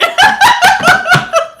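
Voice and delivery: high, nervous shriek of laughter. Real, not acted. Spooked by a spider, she shrieks with laughter. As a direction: laugh it off in high-pitched panic. Scared, feet tucked.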